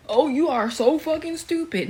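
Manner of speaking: high, scornful mock voice of a shocked stranger; mocking shocked local. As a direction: sneer it like an imagined local. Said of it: "people being shocked to finally see one".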